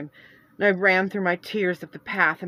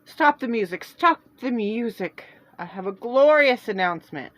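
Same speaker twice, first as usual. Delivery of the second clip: loud, higher call to the crowd; ringing call; 5 semitones higher, 8 dB louder than usual. Acted. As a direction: ring out over the music. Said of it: "I heard a man's voice ring out".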